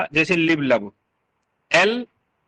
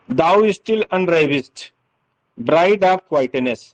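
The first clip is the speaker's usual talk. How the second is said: slow poetic recitation; much slower than usual. Shown as a demonstration. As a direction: read the line very slowly, savoring each word. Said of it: "जैसे ये sentence आप देखिए". (धीमी काव्यात्मक पाठ)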